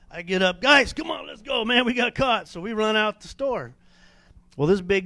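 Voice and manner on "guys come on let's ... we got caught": higher, hurried shout to his buddies; urgent call to flee. Retold or imitated. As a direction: yell at your friends to run, caught red-handed.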